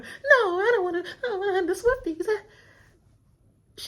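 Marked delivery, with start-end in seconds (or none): 0.0-2.4 much higher, louder, laughing mock whine; mock panicked crowd. The castle panicking about the Swifties, in a high frantic mock voice. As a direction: gabble it fast like a scared crowd.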